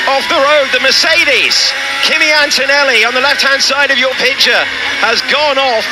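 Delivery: sudden high, urgent play-by-play; excited commentary. Real, not acted. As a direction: raise the pitch as the crash unfolds. Crash spotted during live commentary.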